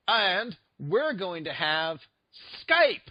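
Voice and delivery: slow build to a high, loud reveal; drawn-out hype, last word punched; mock announcer. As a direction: imitate an over-hyped stage announcement. Mimicking a presenter's big announcement.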